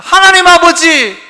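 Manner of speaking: 하나님 아버지 slow, high and loud; fervent prayer call. Shown as a demonstration. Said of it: "여기서부터 시작하는 거예요".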